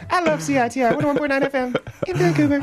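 high, laughing mock station plug; mock announcer voice. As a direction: ham up the station ID in a squeaky voice.

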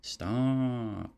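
drawn-out, weary teacher's scolding; annoyed monotone. Retold or imitated. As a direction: imitate a fed-up teacher, stretching the words. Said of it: "get really annoyed and monotone".

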